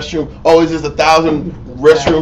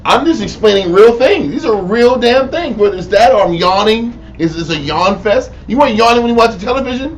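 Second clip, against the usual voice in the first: exasperated rant; 5 semitones higher, 4 dB louder than usual. Acted. Acted rant about yawning listeners.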